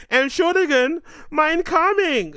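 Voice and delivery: put-on high, slow, loud German accent. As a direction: stretch it out in a hammy German accent. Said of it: "In my German accent".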